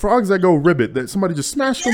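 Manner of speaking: loud, high, disbelieving question; shocked disbelief. Real, not acted. Shocked at smashed frogs.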